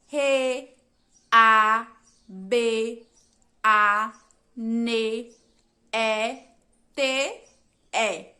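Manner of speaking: each letter name of rabanete said separately; spelling out letters. Shown as a demonstration. Teacher spelling the word letter by letter for the class. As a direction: spell Re, a, be, a, ne, e, te, e with a pause after each. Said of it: "quais são as letras da palavra rabanete".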